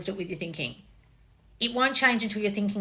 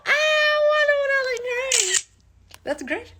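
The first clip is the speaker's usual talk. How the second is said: very high, sing-song and gushing; mock sweet falsetto; 5 dB louder, slower than usual. To her fans reading comments, a high cooing diva voice. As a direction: coo the praise way up high like a pleased diva.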